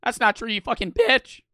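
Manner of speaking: ordinary talk, nothing marked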